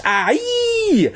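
very high, drawn-out angry shriek; angry scream. A narrator's reading. Drenched boy losing it, angry scream. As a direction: let out a piercing wail of rage. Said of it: "An angry scream tore from my throat".

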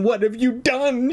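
high, distressed repeat, escalating. A narrator's reading. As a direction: wail the question high in mounting dismay. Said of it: "Her voice trembled more each time".

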